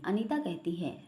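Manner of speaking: ordinary delivery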